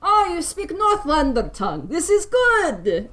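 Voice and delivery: high-pitched hearty Northlander voice; delighted foreigner. Acted. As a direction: greet a stranger who speaks your tongue, delighted. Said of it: "the blonde man, turns and goes".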